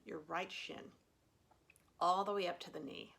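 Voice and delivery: calm meditation guide, long pauses between cues; guided meditation. Real, not acted. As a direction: gently lead them through a body scan, leaving room to breathe. Leads a body scan.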